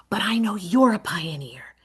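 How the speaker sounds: soft unvoiced whisper. Shown as a demonstration. Teacher assuring a pupil in a whisper. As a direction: whisper it warmly like a confidence. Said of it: "this is what I say to them".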